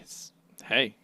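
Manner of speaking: hushed, breathless aside; whisper. Real, not acted. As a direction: whisper it under your breath.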